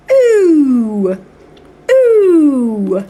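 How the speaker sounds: long drawn-out ooh, like a teacher modelling; ooh sound demo. Shown as a demonstration. Teacher models the ooh sound for the class, drawn out. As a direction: stretch the ooh sound for a child. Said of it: "That's the bit for the ooh".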